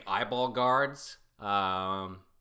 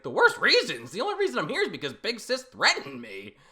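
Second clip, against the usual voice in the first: indignant reply; 12 semitones higher, 8 dB louder than usual. Acted. Boy argues back indignantly.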